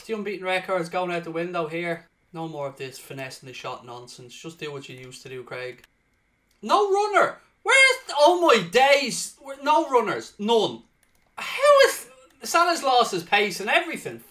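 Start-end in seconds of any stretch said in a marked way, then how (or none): 2.3-5.8 quiet self-talk. Real, not acted. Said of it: "talking himself down". 6.6-14.2 high, loud disbelief at no runners; exasperated yelling. Real, not acted. Gamer shouting at his players for not making runs, exasperated.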